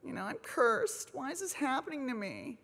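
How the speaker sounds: imitating her own pitiful moaning; mock self-pity. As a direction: whimper like a sorry victim. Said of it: "I was like".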